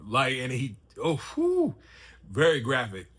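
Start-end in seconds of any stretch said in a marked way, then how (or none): 1.0-1.7 shocked exhaled whew at the gore; high-pitched "whew". Real, not acted. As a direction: blow out a high whistling breath. Recoils from graphic scene.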